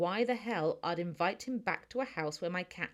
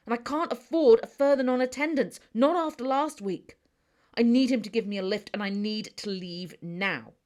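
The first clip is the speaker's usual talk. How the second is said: louder, higher, exasperated outburst; frustrated rant; 4 semitones higher, 8 dB louder than usual. Acted. Stressed brother rants at his sister about his broken car. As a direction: vent it all in one stressed rush.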